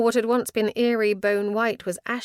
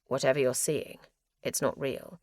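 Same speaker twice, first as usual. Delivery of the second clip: low steady; 5 semitones lower, 3 dB quieter than usual. A narrator's reading. Max holding her back.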